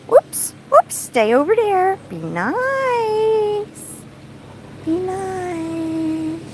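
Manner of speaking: high singsong calling to an animal; high-pitched pet scolding. Real, not acted. Owner telling Ani to stay over there, high coaxing. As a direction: draw out "nice" in a high coaxing voice.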